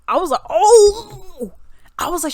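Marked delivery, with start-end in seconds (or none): 0.5-1.5 loud, high, drawn-out oh; shocked realization. Retold or imitated. Reacting to the song's lyrics, loud shocked oh. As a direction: let a big scandalized oh swoop out.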